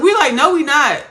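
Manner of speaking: loud, high-pitched refusal; retold class protest. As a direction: protest loudly as the indignant class. Class arguing back at teacher.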